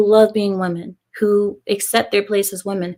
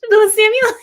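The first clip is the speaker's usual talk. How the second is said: high, loud laughing no; excited protest; 13 semitones higher, 4 dB louder than usual. Real, not acted. Streamer laughs back at Samuel, high excited protest. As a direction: squeal it at him.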